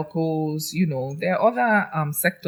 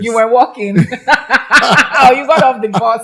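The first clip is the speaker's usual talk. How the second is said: laughing; 5 semitones higher, 8 dB louder than usual. Real, not acted. Teasing laughter between friends.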